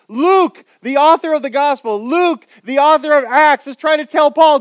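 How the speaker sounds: high, loud, building to a shouted plea; rising to a shout of don't go; preaching at full force. Real, not acted.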